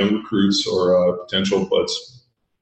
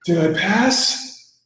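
put-on dazed kid; 11 semitones higher, much slower than usual. Kid revived after blacking out.

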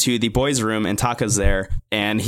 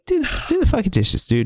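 exasperated; 11 semitones higher than usual. Real, not acted. Exasperated at Yudai.

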